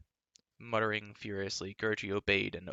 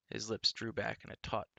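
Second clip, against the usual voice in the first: ordinary delivery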